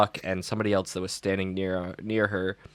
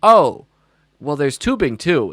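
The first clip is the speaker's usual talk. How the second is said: big high, loud bait; a teacher luring a reluctant kid with tubing; teasing teacher; 5 semitones higher, 9 dB louder than usual. Retold or imitated. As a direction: dangle tubing like a treat. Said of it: "And I was like".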